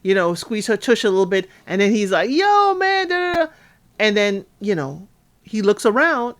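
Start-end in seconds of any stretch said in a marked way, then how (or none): 2.3-3.5 high-pitched joshing imitation; mock bro holler. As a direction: imitate a guy hollering to his buddy. Imitating Joe joking with guys.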